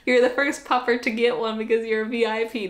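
normal talking voice

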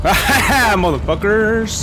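slow, loud, high cry; drawn-out yell. Real, not acted. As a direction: stretch the curse out loud. Enemies appear, drawn-out yell.

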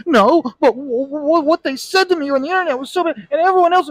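high, whiny and fast; whiny victim. Retold or imitated. Touchy internet user defending hurt feelings, high whine.

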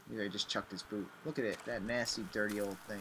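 ordinary talk

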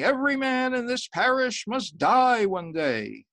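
slow, high, loud preaching; sermon. Acted. Priest warning his parish from the pulpit, loud and slow. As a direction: boom it out from the pulpit.

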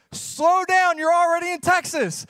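loud, high sign voice read with mock pride; mock announcement. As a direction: boom it out like a proud road sign. Reading out a road sign.

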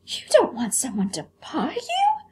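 high astonished doll voice; can't believe anyone wouldn't want a buyer; scandalized china doll, high; shocked vain doll. Acted.

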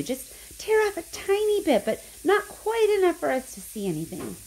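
high-pitched, eager storybook voice; excited instruction. A narrator's reading. Charlie tells Grandpa how to open it, high and excited. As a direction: lift the pitch high with anticipation.

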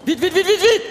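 high, rapid-fire urging. Real, not acted. Coach hurrying players mid-drill, urging on. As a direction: hurry them with high repeated calls.

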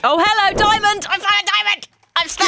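high excited shout; thrilled at finding a diamond. Real, not acted.

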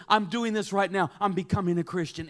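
loud, decisive announcement; firm declaration. Retold or imitated. Augustine pointing, telling Alypius his decision, emphatic declaration. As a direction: announce it with full conviction.

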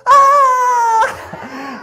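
high frightened wail, loud; frightened cry. Acted. Little boy crying out in fear at the teachers. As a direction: let out a scared childish cry.

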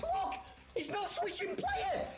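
yelling that the game is broken; raging gamer. Real, not acted. Controls fail mid-game.

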